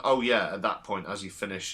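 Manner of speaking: ordinary delivery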